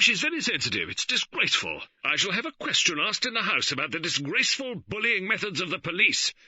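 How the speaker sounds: loud, high indignant bluster. A narrator's reading. George blustering at the police over his wife, loud and high. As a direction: bluster and threaten. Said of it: "George Lee blustered".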